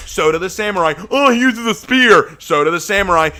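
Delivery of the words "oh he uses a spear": high, loud mimicry of a silly argument; mocking objector. Player mimicking those who defend the Spartan as knight, mocking. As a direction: parrot the objection in a raised, mocking voice.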